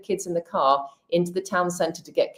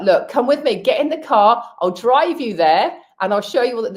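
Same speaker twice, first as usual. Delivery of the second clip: pleading; 6 semitones higher, 6 dB louder than usual. Retold or imitated. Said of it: "And I was like".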